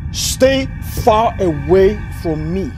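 slow, spaced-out order; deliberate, firm warning; emphatic warning. Acted. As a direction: space out each word as a threat. Warning repeated slowly.

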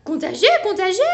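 high, loud alarmed exclaim; squealing hamster. Acted. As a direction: cry it out while running away. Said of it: "s'exclame le petit hamster".

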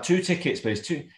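high, mock-careful ticket order; lightly acted plea to be understood; retold repeated request.

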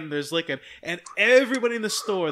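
ordinary delivery

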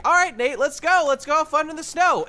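chirpy call to go play; girlfriend voice. Retold or imitated. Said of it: "she's like".

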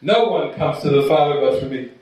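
hammed-up preacher Jesus; mock Jesus. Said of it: "Mary's probably going".

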